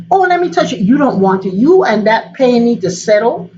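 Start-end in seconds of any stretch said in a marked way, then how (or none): 0.1-0.8 fussing helper. Retold or imitated. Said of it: "somebody say".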